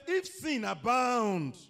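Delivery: high, very loud, drawn-out proclamation; preacher's shout. Real, not acted. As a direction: proclaim the verse at full force. Preacher proclaiming scripture at full force.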